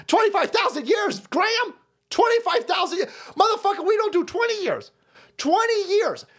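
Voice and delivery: high-pitched incredulous yelling; exasperated outburst. Real, not acted. Host arguing with an absent author over timescales, exasperated. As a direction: throw the figure back in outrage.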